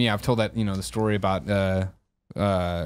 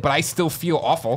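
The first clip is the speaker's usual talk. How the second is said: ordinary talk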